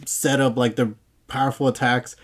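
ordinary delivery

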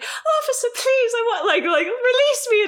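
high, lightly hammed-up pleading woman; a suspect fakes distress for the officer, mock pleading; mock dramatic plea. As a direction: beg the officer with mock tears. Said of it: "crying dramatically".

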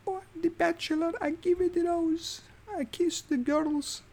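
high, soft, slow mock Italian accent; Italian stallion voice. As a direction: ham up a breathy Italian accent. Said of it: "This is how he talks".

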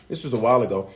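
usual speaking voice

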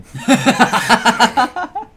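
long, high stuttering laugh; high cackling laugh. Real, not acted. Laughing after the good stuff, high cackle. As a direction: cackle on and on in bursts.

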